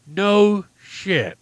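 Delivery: loud, high, drawn-out deadpan reaction; sarcastic exclamation. Real, not acted. Radio host reacts to an absurd emergency report, loudly sarcastic. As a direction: belt out the obvious with mock amazement.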